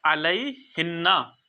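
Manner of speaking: अलैहिन्ना stretched out slowly, doubled न held; slow demo. Shown as a demonstration. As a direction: say अलैहिन्ना slowly, leaning on the न्ना. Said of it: "तो फिर हम उसको पढ़ते". (अलैहिन्ना धीरे और साफ़ उच्चारण में)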